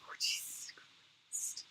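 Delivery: breathless whisper of shock. Real, not acted. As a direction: say it under your breath, slowly. Shocked at the origin story.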